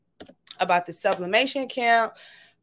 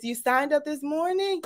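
ordinary talk

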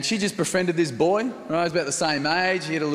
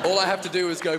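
usual speaking voice

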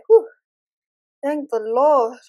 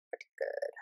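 whispered to yourself, much softer; 13 dB quieter than usual. Real, not acted. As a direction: whisper it like self-reassurance. Repeats phrase, then whispers it.

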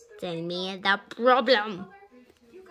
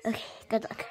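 whispering; 8 dB quieter, much faster than usual. Pretend. Same words said twice.

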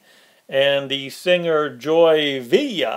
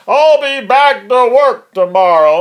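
loud, higher mocking quote of a promise; mock Trump; 7 semitones higher, 8 dB louder than usual. Host throwing the president's promise back at him, loud mockery. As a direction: belt out the empty pledge in mockery. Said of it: "if he lies to American people".

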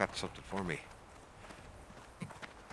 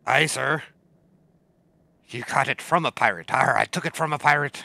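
pirate voice; 4 semitones higher, 8 dB louder than usual. Pretend. Roleplaying pirate to NPC.

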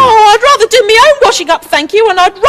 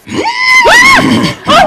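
gasp. Acted. Sees horse in kitchen.

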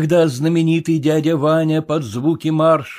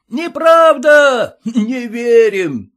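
crowd shout; 11 semitones higher, 7 dB louder, much slower than usual. A narrator's reading.

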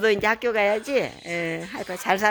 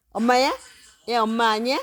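usual speaking voice

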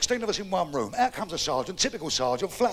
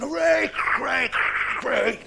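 crowd cheering; 5 semitones higher, slower than usual. Acted.

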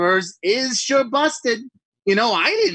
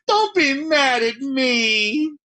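tearful plea; 6 semitones higher, much slower than usual. Acted. Plea to the character.

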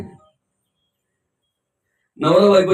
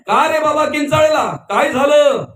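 high, raised-voice questioning; demanding questioner; 8 semitones higher than usual. Acted. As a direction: call through the door to ask what happened, pitched high. Ravana questions the screaming man. (चढ्या आवाजात जाब विचारत)

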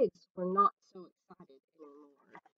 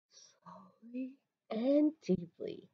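slow unvoiced whisper; calm meditation whisper; 9 dB quieter, slower than usual. A narrator's reading. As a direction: breathe it out in a hush. Duck breathing out.

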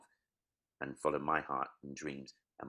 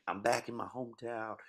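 higher-pitched showbiz homecoming line; mock American star; 7 semitones higher than usual. Comic mimicking Americans on Netflix returning home, hammed-up. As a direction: ham it up like a TV celebrity.

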